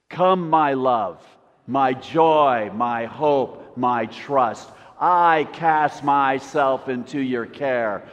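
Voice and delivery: slow, loud, fervent reading; emphatic recitation, phrase by phrase; devotional declamation. Acted. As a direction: lead a congregation in reciting. Leads congregation in reading aloud.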